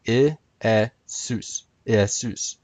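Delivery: IESUS broken into ee, eh, SOOS; classical Greek pronunciation. Shown as a demonstration. Presenter breaking it down for viewers, classical Greek pronunciation. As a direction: sound out Iesus by syllables, then join it. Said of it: "would have been pronounced Iesus".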